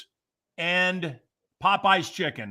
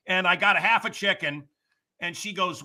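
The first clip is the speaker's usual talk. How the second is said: normal talking voice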